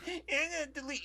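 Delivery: high, laughing mock whine; mock a tattletale threatening to report; whiny mock voice. Mocking people who report videos, in a high whiny voice.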